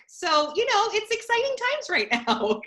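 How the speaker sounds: high-pitched, breaking into a laugh; real amused lift into laughter; wry amusement. Real, not acted. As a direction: joke it brightly, laugh bubbling up at the end. Jokes about daughter's pink face.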